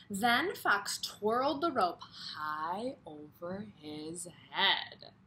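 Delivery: slow storybook read; reading to children. Acted. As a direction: draw out the action for the kids. Reads the story aloud.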